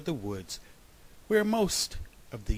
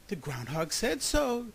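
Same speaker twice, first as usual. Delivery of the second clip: higher-pitched happy exclamation; excited cry; 9 semitones higher than usual. A narrator's reading. Retail investor dancing in the snow, excited cry. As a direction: pass on thrilling news. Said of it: "the retail investor cried".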